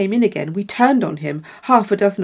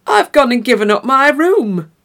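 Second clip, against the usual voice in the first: louder, higher aghast cry; a louder dismayed complaint; dismayed outburst; 4 semitones higher, 7 dB louder than usual. Acted. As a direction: wail it in disbelief. Large man dismayed at loss.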